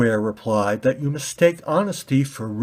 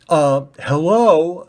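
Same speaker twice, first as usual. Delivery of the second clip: slow, louder mocking hello; sarcastic hello; 6 semitones higher, 7 dB louder, slower than usual. Red ball mocking the pointy ball, slow sarcastic. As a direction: knock knock, anyone home.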